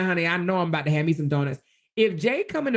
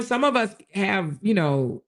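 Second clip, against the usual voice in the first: normal talking voice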